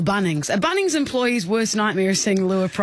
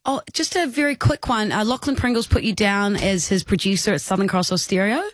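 usual speaking voice

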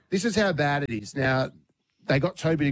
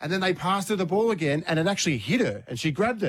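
ordinary talk, nothing marked

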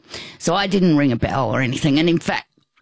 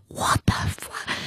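whispered shock; 7 dB quieter than usual. Retold or imitated. Shocked thought in church.

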